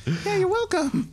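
high, cheery farmer voice; farm parent. Acted. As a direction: chirp it out brightly. Farmer voice in role-play.

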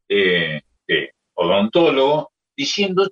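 ordinary talk

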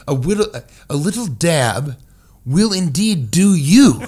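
hammed-up proper diction; mock formal. Shown as a demonstration. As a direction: enunciate every word like a stiff Victorian. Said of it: "Why don't people just say the whole thing".

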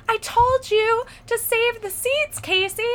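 high, slow mock scolding; exasperated boyfriend. Boyfriend scolding over unsaved seats, high exasperated voice. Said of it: "he throws his hands up".

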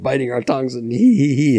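usual speaking voice

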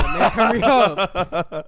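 loud, drawn-out mock yell; playful hurry-up shout. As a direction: holler it like a joking curfew warning. Mock curfew hurry-up call.

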